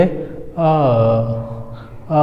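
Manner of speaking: अ drawn out long, held vowel; stretched vowel. Shown as a demonstration. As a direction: stretch the अ sound. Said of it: "थोड़ा सा खिंचाव होगा".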